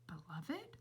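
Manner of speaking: soft unvoiced puzzled question; whispered thought. A narrator's reading. Flora thinking to herself in a whisper. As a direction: whisper it to yourself. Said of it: "thought Flora".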